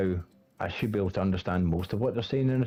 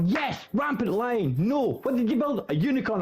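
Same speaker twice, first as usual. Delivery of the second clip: mock outrage; 12 semitones higher than usual. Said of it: "and I thought".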